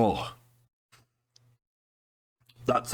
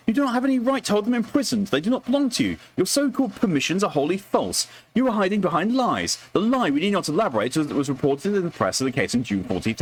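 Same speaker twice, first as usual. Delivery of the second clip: much higher, formal indignant reading; indignant letter voice; 10 semitones higher than usual. Acted. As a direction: read the complaint like a wronged aristocrat. Reads complaint letter in character.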